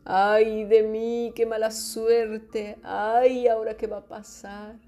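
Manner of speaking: hammed-up woe-is-me; mock lament. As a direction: wail slowly in exaggerated self-pity. Acted lament Abraham never made.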